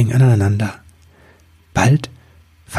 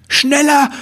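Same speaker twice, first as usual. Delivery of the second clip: much higher shouted urging; bellow. Acted. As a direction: yell to drive the weasel on. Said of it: "brüllte Ribbelwitsch".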